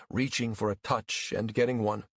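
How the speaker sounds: ordinary delivery, nothing marked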